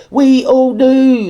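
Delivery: slow, loud, each word hammered; We all knew, drawn out and pounded; slow emphatic. Real, not acted. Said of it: "emphatic slow".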